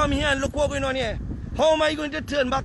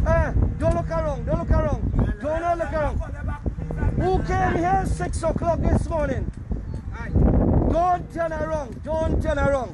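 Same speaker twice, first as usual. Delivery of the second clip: raised, higher commanding call to the crowd; 3 semitones higher than usual. Real, not acted. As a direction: order the crowd to keep facing forward. Ordering the crowd not to turn.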